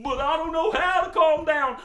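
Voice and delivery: high, loud doggy wail; over-excited hound dog. Pretend. Dog told to calm down, excitedly wailing. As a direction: wail it like a dog too worked up to stop. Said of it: "he's so excited".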